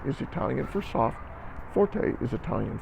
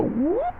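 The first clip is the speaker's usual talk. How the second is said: a high playful whoop. Shown as a demonstration. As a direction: sweep up like jumping over. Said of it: "jump to second ending".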